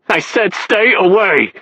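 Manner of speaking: high, slow, furious yell; slow furious shout, pitched high. Acted. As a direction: drive him off, every word hammered. Beaten man rejects help again.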